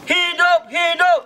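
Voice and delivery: high, loud rally chant; fiery revolutionary speech. Real, not acted. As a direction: chant it out to the crowd. Leads protest chant call.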